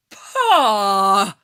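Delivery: loud, dismissive scoff; scornful pah. A narrator's reading. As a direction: scoff at the idea. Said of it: "I said".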